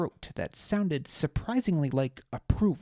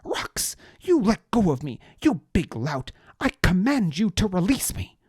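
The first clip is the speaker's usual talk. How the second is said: high, loud haughty noble voice; indignant nobleman; 7 semitones higher, 9 dB louder than usual. A narrator's reading. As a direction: order him haughtily. Deliritus commanding while held.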